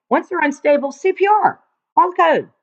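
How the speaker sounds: high, emphatic drill of emergency steps; urgent instruction. Shown as a demonstration. Nursing teacher drilling students on the code response, urgent and emphatic. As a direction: fire off the steps with rising urgency.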